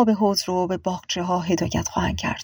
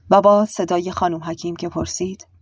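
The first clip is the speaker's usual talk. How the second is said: usual speaking voice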